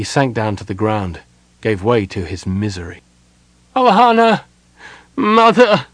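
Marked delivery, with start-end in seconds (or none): 3.8-5.9 loud, high cry of agony; agonised cry. A narrator's reading. Son calls to his mother in agony. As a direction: call out to her in anguish. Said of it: "he said in agony".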